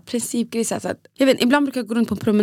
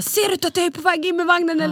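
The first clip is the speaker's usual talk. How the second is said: imitated yelling; 8 semitones higher than usual. Man yelling at the train driver, high-pitched mock shout. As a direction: imitate an indignant yell at normal volume. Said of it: "står han och skriker".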